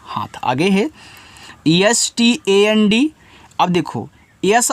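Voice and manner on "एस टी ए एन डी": slow letter-by-letter spelling; each letter drawn out. Shown as a demonstration. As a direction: spell it out slowly for the class. Teacher spells word by letters.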